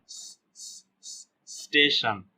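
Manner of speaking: repeated voiceless "sss" before saying स्टेशन; drawn-out half S. Shown as a demonstration. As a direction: hiss the bare s, then finish स्टेशन slowly. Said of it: "आधा स का".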